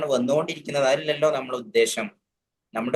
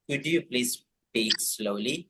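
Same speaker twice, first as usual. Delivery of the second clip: slow polite model; 6 dB quieter, much slower than usual. Shown as a demonstration. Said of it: "വളരെ perfect ആയിട്ടുള്ള ഒരു sentence".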